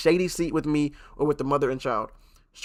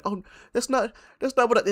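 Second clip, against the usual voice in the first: much higher, stammering mock protest; mock flustered mother; 9 semitones higher than usual. Streamer imitating the embarrassed mother, pitched up and flustered. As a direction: play her caught out and backpedalling. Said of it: "This is gonna be like".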